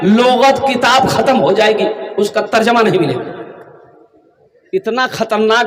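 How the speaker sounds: ordinary talk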